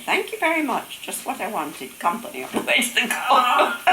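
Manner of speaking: high, teasing sing-out of thanks; mock-taunting thanks. Brother who got her to chase him to the golf club, high mock thanks. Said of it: "he'd keep taunting her".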